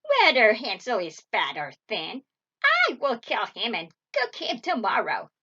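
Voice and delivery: loud, wicked witch threat; menacing witch. A narrator's reading. Impatient witch decides to cook Hansel, loud threat. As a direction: declare it loudly with relish. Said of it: "she ordered the young girl".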